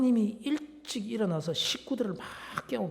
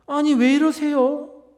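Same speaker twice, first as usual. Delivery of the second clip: higher, louder, slowed startled question; 6 semitones higher, 9 dB louder, slower than usual. Retold or imitated. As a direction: act out a startled wife asking her husband. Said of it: "놀라서 묻습니다".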